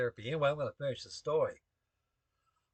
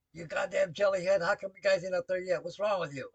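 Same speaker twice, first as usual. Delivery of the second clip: higher-pitched, retold chief's chewing-out; chief's scolding; 6 semitones higher, 3 dB louder than usual. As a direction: imitate an exasperated chief berating a sailor.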